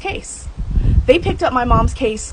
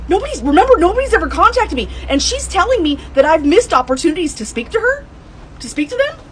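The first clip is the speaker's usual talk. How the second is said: indignant; 8 semitones higher, 4 dB louder than usual. Real, not acted. Outraged at being misrepresented.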